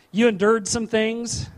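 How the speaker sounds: ordinary delivery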